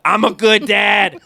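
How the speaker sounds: mock proud declaration. Dad defends himself after the bathroom story, emphatic mock boast. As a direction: proclaim it slowly with comic insistence.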